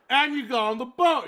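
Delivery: loud, high interrupting listener. Acted. Listener cutting in on a story, loud interruption. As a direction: butt in loudly as the eager listener. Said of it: "who was listening to the story goes".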